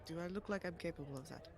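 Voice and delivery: low, soft self-deprecation; meek, quiet appeal. Acted. Oleander asking guards if he looks dangerous, meek and quiet. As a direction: ask it small and low. Meek character doubts own threat.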